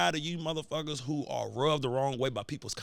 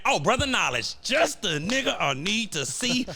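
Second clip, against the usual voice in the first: a light, slow put-on Prime greeting, a little higher and louder; lightly voiced Coach Prime, slow and showy; imitating Prime; 4 semitones higher, 3 dB louder than usual. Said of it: "So he was saying".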